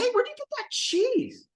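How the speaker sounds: high-pitched nosy questioner; joke character. Acted. Man approaching cheese eater in a joke, high-voiced. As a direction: pipe up high, rising on the question.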